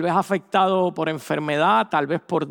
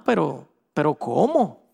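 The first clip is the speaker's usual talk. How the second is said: stunned disbelief; 4 semitones lower than usual. Pretend. Stunned patient.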